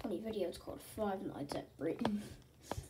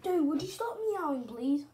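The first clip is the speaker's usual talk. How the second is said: raised, louder, fed-up request; exasperated plea; 4 semitones higher, 6 dB louder than usual. Real, not acted. Telling someone to stop meowing, high exasperated plea. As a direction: call out in annoyance, pitched high.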